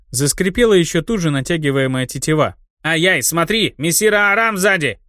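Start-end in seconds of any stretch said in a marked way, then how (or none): 2.8-5.0 frightened yell. A narrator's reading. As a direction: yell in fake fright to distract. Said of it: "испуганно завопил гоблин".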